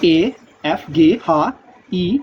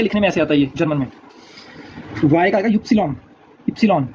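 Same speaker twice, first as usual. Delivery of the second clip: normal talking voice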